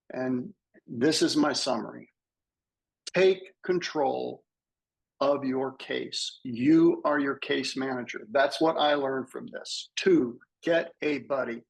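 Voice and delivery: ordinary delivery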